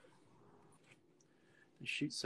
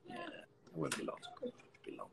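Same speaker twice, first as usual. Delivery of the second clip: whisper; 13 dB quieter than usual. Real, not acted. Soft reassuring whisper.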